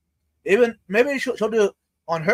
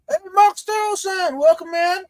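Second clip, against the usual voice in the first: cheery greeting; 11 semitones higher, slower than usual. Real, not acted. Host greets a viewer.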